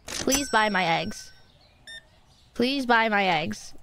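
usual speaking voice

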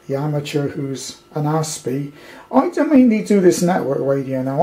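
ordinary talk

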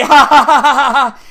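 loud fake taunting laughter; sarcastic ha-ha-ha; mocking laugh. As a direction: imitate a rival laughing at you. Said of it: "He's sitting back laughing at me".

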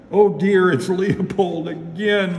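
dismayed. A narrator's reading. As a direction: exclaim in dismay as a little girl. Girl dismayed by her pet.

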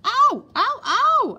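piercingly high yelps of pain; loud yelps. A narrator's reading. Boy fakes agony as the matron prods, yelping loudly. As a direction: yelp at full volume, each one separate. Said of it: "let out a yelp that rattled the window panes".

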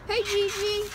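high sing-song call to a dog; puppy voice. Real, not acted. Calling to the puppy below.

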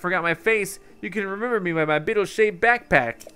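high-pitched merchant with a put-on accent; Beetle. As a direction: introduce yourself in a high, showy salesman's accent. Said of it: "why I gave him that accent".

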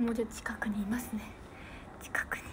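soft unvoiced whisper; hushed whisper aside. Real, not acted. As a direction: lean in and whisper it like a secret. Secret aside to the fans.

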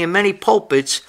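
ordinary delivery

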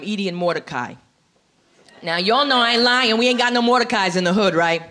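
ordinary talk

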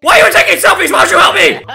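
high, loud, indignant yelling; exasperated shouting. Real, not acted. Player scolding selfie-takers, exasperated shout. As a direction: shout at friends who ignore you.

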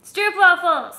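high, loud excited call-out; excited announcement. Real, not acted. Wife revealing the snack box to husband, excited. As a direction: announce the treat with a burst of excitement.

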